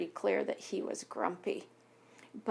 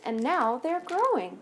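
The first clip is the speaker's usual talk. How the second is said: plain reading voice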